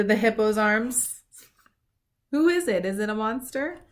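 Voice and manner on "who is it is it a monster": ordinary delivery, nothing marked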